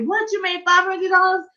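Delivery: high, breaking with excitement; excited husband. Retold or imitated. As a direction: urge her on, thrilled and squealing. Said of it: "he was like".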